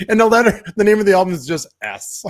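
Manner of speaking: chuckling through the punchline; laughing. Real, not acted. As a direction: grin and laugh as you say it. Joke, laugh heard.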